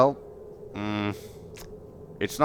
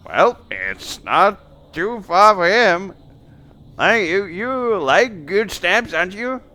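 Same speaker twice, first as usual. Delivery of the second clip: coaxing innkeeper; 9 semitones higher, 5 dB louder than usual. Acted. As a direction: lay on the coaxing, loud and high. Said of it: "And she's just like".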